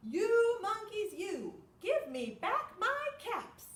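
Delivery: high, loud, slow mock-angry demand; hammed-up cross peddler. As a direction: shake your fist and scold the monkeys. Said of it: "that made the peddler very angry".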